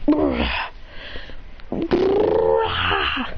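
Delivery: low defeated monster groan; fainting creature groan. Acted. Lucario groaning low as it is knocked out. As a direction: groan out low as the beast goes down. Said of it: "As he goes".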